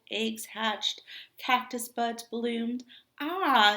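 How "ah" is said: a loud, pleased sigh of relief; Tortoise savoring the morning; contented ah. Acted. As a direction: sigh it out like waking to spring. Tortoise emerging happily.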